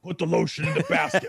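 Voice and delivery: a hint of a creepy captor voice; lightly voiced menacing order; movie killer line.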